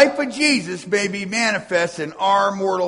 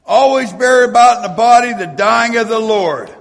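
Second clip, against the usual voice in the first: loud, high, cadenced preaching of the verses; preaching scripture; 6 semitones higher, 11 dB louder than usual. Acted. Preacher proclaiming Paul's letter to his congregation, full force. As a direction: proclaim it to the whole church.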